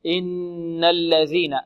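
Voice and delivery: slow إن الذين with the n of إن held nasally; إن الذين, long hummed n; full ghunna. Shown as a demonstration. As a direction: linger on the doubled ن in إن. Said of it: "النون المشددة غنة أكمل".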